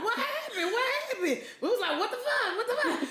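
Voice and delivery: high, laughing what-the; half-laughing outrage; mock shocked disbelief. Quoting shocked viewers.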